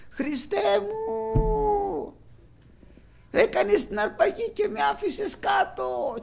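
high, slow, wailing sob of terror; panicked crying wail. Retold or imitated. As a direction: cry out in panic, as if left behind. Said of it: "Και βάζει κάτι φωνές". (κλαψιάρικη πανικόβλητη φωνή, ψηλά και αργά)

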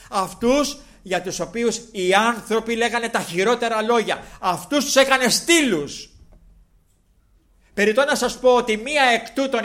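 loud, high impassioned sermon voice; fiery preaching. Acted. Preacher to congregation about Mary Magdalene, fiery full-force preaching. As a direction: proclaim it to the whole church.

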